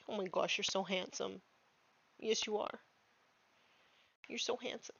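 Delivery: soft, doting praise for a pet; cooing at the dog. Real, not acted.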